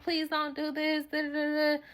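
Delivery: high, soft mock begging; imitated pleading. Friend begging her not to do this, mock pleading.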